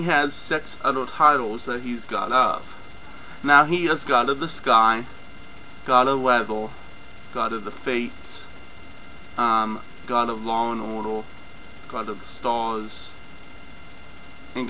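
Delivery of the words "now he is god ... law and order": usual speaking voice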